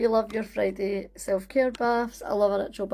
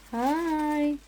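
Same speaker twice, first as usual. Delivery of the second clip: higher, sing-out mummy voice; 6 semitones higher than usual. Real, not acted. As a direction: greet your little one sweetly. Greets little girl who came in.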